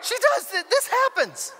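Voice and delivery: loud, high-pitched insistence; incredulous exclamation. Real, not acted. Pastor insisting the story is true, high incredulous exclamation. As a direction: cry it out high in disbelief.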